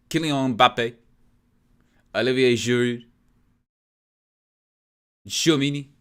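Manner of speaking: player names said in a put-on French accent. Streamer commentating France's match, mock French accent. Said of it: "I gotta try and do my best French accent".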